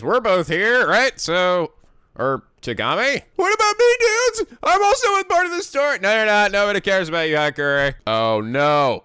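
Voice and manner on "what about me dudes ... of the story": high whiny voice. Acted. Whiny character voice.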